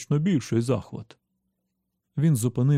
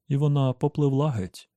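usual speaking voice

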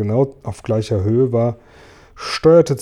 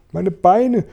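higher indignant yelp; outraged cry; 7 semitones higher than usual. A narrator's reading. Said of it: "schrie Tausendfüßler empört".

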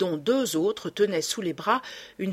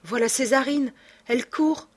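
alarmed cry; 6 semitones higher than usual. A narrator's reading. Raymonde sees Césarine running.

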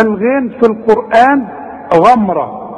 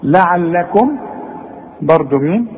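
ordinary talk, nothing marked

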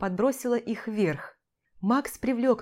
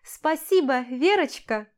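excited thanks; 5 semitones higher, 4 dB louder than usual. A narrator's reading. Bouquet catcher calls thanks.

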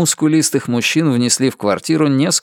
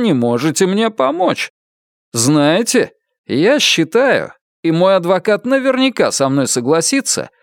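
raised-pitch, emphatic confrontation; indignant; 5 semitones higher than usual. A narrator's reading. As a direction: lean on the words with mounting indignation. Indignant client confronts evasive agent.

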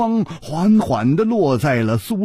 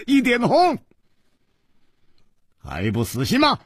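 laughing taunt; 9 semitones higher than usual. A narrator's reading. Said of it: "南宫绫大笑".

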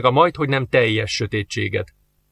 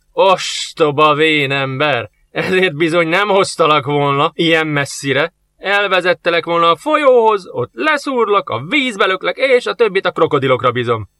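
mocking; 5 semitones higher, 3 dB louder than usual. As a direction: sneer at the foolish old man. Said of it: "gúnyosan felnevetett".

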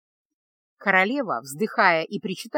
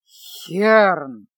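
louder, revolted call; disgusted; 2 semitones lower, 6 dB louder than usual. A narrator's reading. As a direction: bark his name in disgust. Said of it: "брезгливо произнёс король". (брезгливо, громче)

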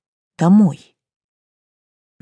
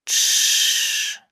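soft, breathy shush; 3 dB quieter than usual. A narrator's reading. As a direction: hush her gently. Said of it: "Не позволяю ей договорить".

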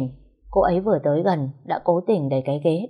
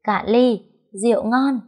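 put-on higher, cheerful drunken toast in sleep; dream cheer; 6 semitones higher, 3 dB louder than usual. A narrator's reading. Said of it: "cô ấy lại reo lên". (reo lên vui vẻ trong cơn mơ)

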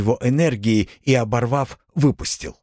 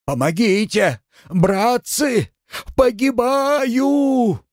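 panicked yelling; 14 semitones higher, much slower than usual. A narrator's reading. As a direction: bellow for help in panic. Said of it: "заорал благим матом".

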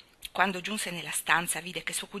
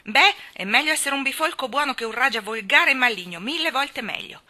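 loud, higher indignant retort; angry comeback; 7 semitones higher, 8 dB louder than usual. Acted. Mary ranks Deacon above Colin, angry. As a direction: hit back, heated.

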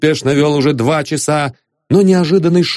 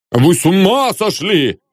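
higher-pitched shocked outcry; alarmed, raised-pitch protest; startled exclamation; 6 semitones higher than usual. A narrator's reading. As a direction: exclaim as if jumping up. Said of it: "воскликнул инженер, вскакивая".

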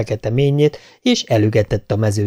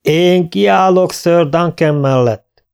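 slow, loud pledge; proud declaration; 7 semitones higher, 4 dB louder, slower than usual. A narrator's reading. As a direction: declare it for all to hear. A knight answers the challenge.

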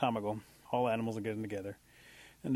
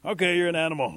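loud, raised-pitch announcement; bear's verdict; 6 semitones higher, 9 dB louder than usual. Acted. Bear accepts the little animal before everyone, declaring. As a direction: declare it loudly to all.

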